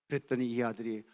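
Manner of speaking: ordinary delivery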